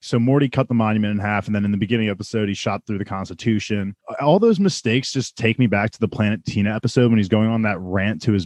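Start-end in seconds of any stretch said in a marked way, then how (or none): none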